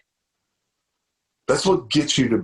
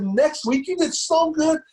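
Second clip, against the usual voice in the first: much higher, bubbling with pride; proud, excited praise; 14 semitones higher, slower than usual. Retold or imitated. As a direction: gush over your son, high and bright with pride. Proud dad praising son.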